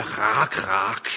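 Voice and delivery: low, drawn croak of a raven; raven call. Retold or imitated. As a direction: imitate a raven's caw. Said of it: "der siger".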